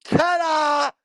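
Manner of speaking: high, loud showman's ta-da; triumphant ta-da. Acted. Hyping the lads on stream with a loud, high ta-da. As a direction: announce it like a grand reveal.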